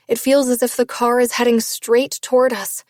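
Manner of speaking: plain reading voice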